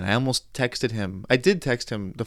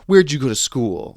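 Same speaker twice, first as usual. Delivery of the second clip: retold mad; 3 semitones higher, 7 dB louder than usual. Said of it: "he got mad at me".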